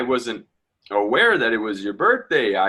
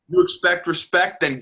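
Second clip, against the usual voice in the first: sharp comeback, pitched up high; defiant retort; 7 semitones higher than usual. Acted. Young man defying the old father, sharply defiant. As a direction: throw it right back at him.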